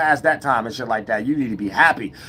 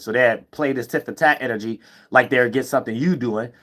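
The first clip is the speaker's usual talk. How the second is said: ordinary talk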